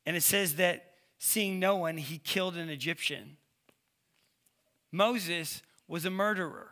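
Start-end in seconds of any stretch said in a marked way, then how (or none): none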